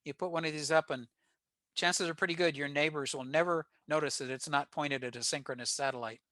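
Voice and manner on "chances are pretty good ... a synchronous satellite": normal talking voice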